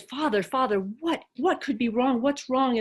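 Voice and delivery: mildly acted urgent concern; alarmed monk. Monk asking the weeping abbot what's wrong, alarmed.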